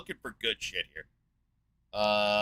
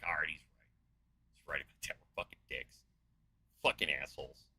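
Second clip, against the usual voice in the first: mock resigned people; 6 semitones lower, 6 dB quieter, faster than usual. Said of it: "people will be like".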